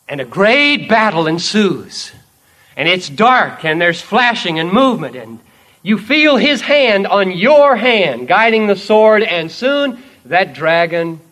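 loud, building battle narration; dramatic preaching. Acted. Preacher narrating the battle with the dragon, loud and building. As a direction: build the fight to its peak.

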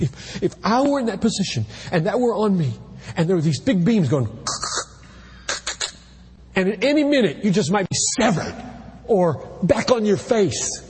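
high, urgent panicked plea; imagined terror. Pretend. Preacher imagining being trapped, frightened high voice. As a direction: voice rising in imagined panic under beams.

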